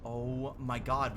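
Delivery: usual speaking voice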